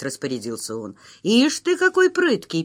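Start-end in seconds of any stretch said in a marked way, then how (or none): 1.2-2.6 higher, teasing jab at an upstart; sly mockery, pitched up; mocking retort. A narrator's reading. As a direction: cut him down with a mock. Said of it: "перебил его Михалыч".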